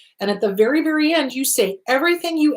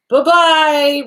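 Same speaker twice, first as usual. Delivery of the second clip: loud, high sing-song send-off; breezy dismissal; 5 semitones higher, 6 dB louder than usual. Acted. Speaker sending an unwanted thought away, breezily dismissive. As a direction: wave it away with a chirpy raised farewell.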